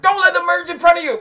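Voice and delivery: high, loud panicked yell; girlfriend yelling. Retold or imitated. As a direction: shriek it at the driver. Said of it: "and she's like".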